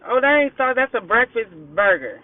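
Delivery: ordinary talk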